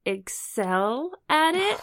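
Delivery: slow, laughing delivery; drawn out through giggles; amused pun. Acted. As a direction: barely hold back laughter on the pun. Cracking up at own pun.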